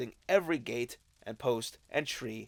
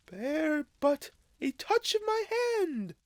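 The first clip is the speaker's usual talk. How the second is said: ghostly spirit voice. Acted. Ghost reassures Scrooge.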